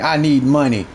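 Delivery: low mock "I need money"; mocking the viewer. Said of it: "what the fuck you mean".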